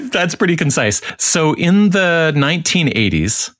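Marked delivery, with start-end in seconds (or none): none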